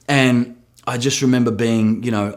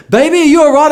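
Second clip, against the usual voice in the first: high, louder worried call; worried wife; 7 dB louder, faster than usual. Retold or imitated. Wife finds him lying in the park, high worried call. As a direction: sound like a wife anxious for her husband. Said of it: "she came".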